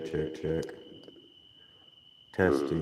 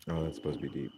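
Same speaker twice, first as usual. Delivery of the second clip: ordinary talk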